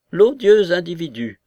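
loud, high burst of indignation; indignant grande dame exclaiming; explosive cry. A narrator's reading. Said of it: "s'écria-t-elle, avec une nouvelle explosion".

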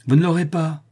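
curt angry refusal; brutal refusal. A narrator's reading. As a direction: slam the refusal down. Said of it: "reprit brutalement la louve".

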